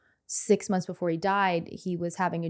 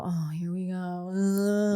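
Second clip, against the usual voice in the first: mock exasperation; slower than usual. Daughter imitating herself and mom hearing dad's slogan, mock exasperated. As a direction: groan it like a family eye-roll.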